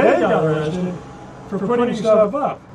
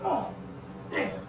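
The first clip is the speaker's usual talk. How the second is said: leg cramp pain; 7 semitones higher, 16 dB quieter than usual. Real, not acted. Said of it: "make noises like this".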